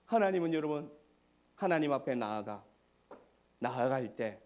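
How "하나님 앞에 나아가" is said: usual speaking voice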